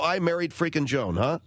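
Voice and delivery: ordinary talk, nothing marked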